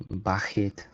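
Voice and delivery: baguette with a French r-like kh and open e; French pronunciation. Shown as a demonstration. Streamer showing how the French say baguette, French accent. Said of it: "Or as the French would say".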